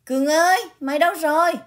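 loud, high call across the yard. Acted. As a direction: call out loudly from the house. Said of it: "tiếng chị Thúy vang lên".

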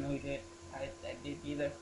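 ordinary talk

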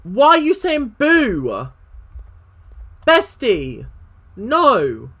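high, loud, dismayed wail; loud protest. Real, not acted. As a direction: cry out in protest at being spooked. Protesting at a boo in game.

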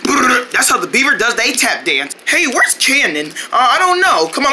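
normal talking voice

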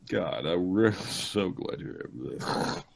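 unvoiced sarcastic whisper. Real, not acted. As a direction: breathe it out sarcastically.

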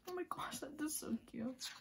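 high, soft squeal of delight; gushing whisper. Real, not acted. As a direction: whisper it, melting. Gushing over photo.

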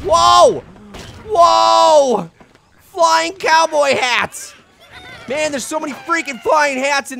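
high, loud, stretched-out whoa; excited shouting. Real, not acted. Gamer spots flying hats, loud excited shout. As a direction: yell in delighted surprise at the hats.

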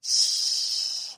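drawn-out hushing hiss; one long, steady "shh"; long shush. Acted. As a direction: shush a crying baby. Shushing a crying baby.

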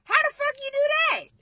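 high, loud disbelieving shout; shocked yelp. Real, not acted. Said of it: "I could cuss".

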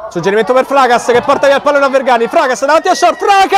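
fast build to a shout; breathless italian goal commentary. Real, not acted. Attack building to second goal.

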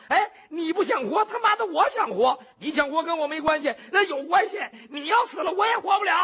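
high, loud, panicked outburst; desperate jailer shouting in a panic; flustered panic. Acted. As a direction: blurt it out in a frantic rush. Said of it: "这猪八皮一着急说实话了".

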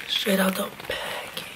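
soft ASMR-style whisper. Shown as a demonstration. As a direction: breathe it out in a whisper. Repeating the phrase in varied ways.

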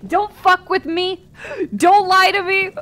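high, loud, hammed-up outburst; mock indignation. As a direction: lash out at your audience in pretend hurt, high and slow. Mock outburst at chat over accent.

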